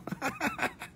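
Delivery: short high-pitched laugh; a quick squeaky laugh. Real, not acted. Laughs at own joke.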